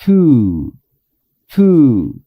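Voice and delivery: Two said slowly and clearly; teacher modeling Two; model pronunciation. Shown as a demonstration. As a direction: model the word Two for students. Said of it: "¿Cómo debo de pronunciar esta palabra".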